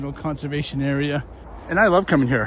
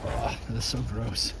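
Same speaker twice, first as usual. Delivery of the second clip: usual speaking voice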